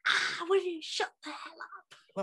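mock exasperated outburst. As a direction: voice a wife at the end of her tether, half joking. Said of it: "as mum said ... wanted to just go".

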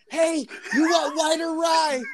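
high, slow call to another room. Retold or imitated. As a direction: yell it down the hall. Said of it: "yelling at his brother from the other room".

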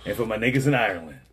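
big show host opening; loud announcer welcome; ringmaster. Acted.

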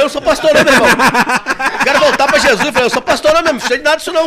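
loud, high, laughing refusal; excited protest. Retold or imitated. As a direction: brush him off, all worked up. Protesting he is no pastor.

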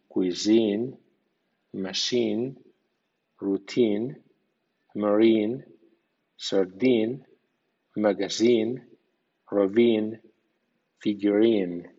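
cuisine, machine, routine ending in a stressed "een"; English pronunciation demo. Shown as a demonstration. Teacher models stressed ine endings, careful pronunciation. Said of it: "suena in".

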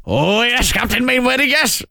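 high, simpering Welsh voice; snivelling Welshman. Retold or imitated. Dad's Army Welshman fawning over Captain Mainwaring, simpering Welsh. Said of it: "weird, snivelling Welsh character".

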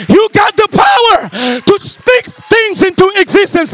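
loud, high, shouting preacher building to a crescendo; preaching at full force. Acted.

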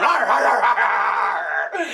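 gruff growls over a bone; growling dog. Acted. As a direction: growl while grabbing the bone.

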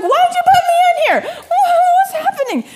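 put-on very high, loud baby bewilderment; baby's confused voice. Baby Jed in the playpen, high confused voice. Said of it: "he was like".

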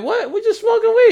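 very high, fast pleading. Retold or imitated. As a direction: whine it like a stoned kid. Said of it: "He's like".

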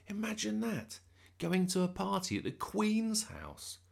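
excited wonder. A narrator's reading. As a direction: marvel at it. Bertie's daydream.

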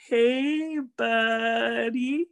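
a light take on his friend's greeting; impression of his friend. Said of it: "your impersonation of me".

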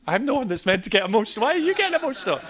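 rising fast to a high, baffled squeak; mock-bewildered protest; incredulous. Incredulous at the weeping man.

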